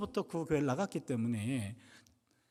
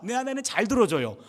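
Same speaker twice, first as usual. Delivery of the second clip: ordinary talk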